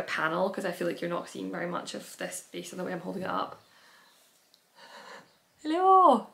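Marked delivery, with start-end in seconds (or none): 5.6-6.3 cheery high hello. Real, not acted. Greeting someone off camera.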